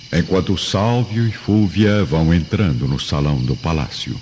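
usual speaking voice

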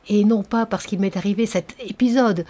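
ordinary delivery

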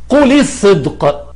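قل الصدق slowly as "quli-s-sidq"; linking kasra. Shown as a demonstration. Teacher giving a second example, قل linked with an i. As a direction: add an i to قل and double the s of الصدق. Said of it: "اشتغال المحل بحركة الكسر".

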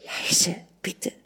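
hushed whisper; whispered plea. Acted. Mother pleading for quiet by sleeping child, whispering. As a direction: whisper it as a soft appeal. Wife begs husband for quiet.

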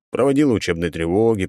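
plain reading voice

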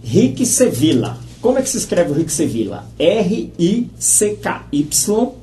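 slow, spelled out letter by letter; over enunciating. Shown as a demonstration. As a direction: spell it slowly and clearly. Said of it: "vou falar bem devagar". (bem devagar, soletrando)

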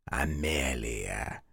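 низкий, тихий, медленный голос демона; медленно и ядовито, смакуя; язвительно. A narrator's reading. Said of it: "съязвил монстр". (демон насмехается над Люком, тихо и низко)

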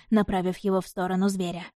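ordinary delivery

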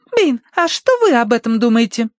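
squeaky, high eager question; high girlish voice. A narrator's reading. Tossie asking the butler's opinion, eager.

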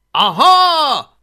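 loud, high, drawn-out gotcha; Hablaty's triumphant cry. Acted. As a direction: crow in triumph at full volume. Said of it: "kiáltott fel Hablaty diadalittassan".

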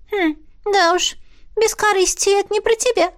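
snide little animal; drawling otter voice. A narrator's reading. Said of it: "протянула зверушка".

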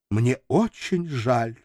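slow, on the verge of tears; almost sobbing. Acted. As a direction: hold back tears as you say it. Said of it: "едва не рыдая".

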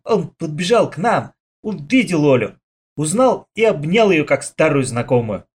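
ordinary delivery, nothing marked

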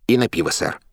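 high, squeaky interjection; little squeak. A narrator's reading. Katsman chimes in to his captain, squeaking. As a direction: pipe up in a small high voice. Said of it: "пискнул Кацман".

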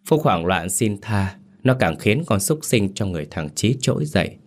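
ordinary delivery

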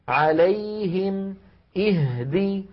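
slow chanted "عليهم", "اهدي", y and h held alike; match the y of "عليهم" to the h of "اهدي"; equal lengths. Shown as a demonstration. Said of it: "نفس الطول". (تساوي الزمن في "عليهم" و"اهدي")

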